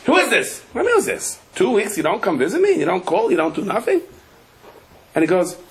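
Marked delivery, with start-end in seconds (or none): 0.1-4.0 put-on high, nagging old mother voice; scolding old mother. Mother on the phone scolding her son, high and nagging.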